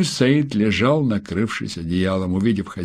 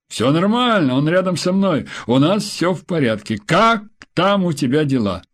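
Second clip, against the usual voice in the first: husband reassuring; 7 semitones higher than usual. A narrator's reading.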